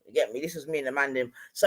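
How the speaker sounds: ordinary talk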